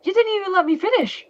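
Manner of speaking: indignant. Acted. Cut off by Denji.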